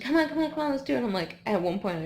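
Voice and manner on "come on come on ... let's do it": higher, louder coaxing; mock encouraging friend; urging friend. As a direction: push her on like a pacer. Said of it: "she's like".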